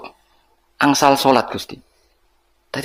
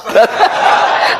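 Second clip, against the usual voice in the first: laugh; 10 semitones higher than usual. Real, not acted. Audience joke about prayer.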